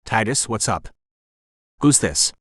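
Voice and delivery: put-on light Spanish accent, older man; a hint of a Spanish accent; slight Spanish accent. A narrator's reading. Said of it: "the man said in a slight Spanish accent".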